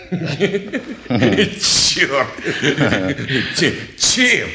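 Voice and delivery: amused, laughing through the question. Acted. Shopkeeper laughs at the strange visitor, laughing. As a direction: chuckle as you ask.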